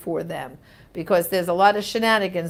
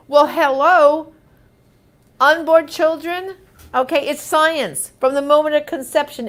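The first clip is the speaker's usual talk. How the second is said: sarcastic emphasis; 6 semitones higher, 6 dB louder than usual. Real, not acted. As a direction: spell it out, mocking. Sarcastic retort to Harris.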